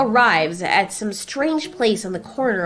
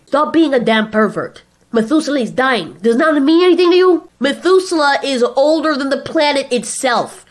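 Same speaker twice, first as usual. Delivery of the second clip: raised, higher-pitched irritated voice; exasperated outburst; 7 semitones higher, 6 dB louder than usual. Acted. Friends quarrel over dying Methuselah, exasperated outburst. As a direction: argue it with heavy emphasis.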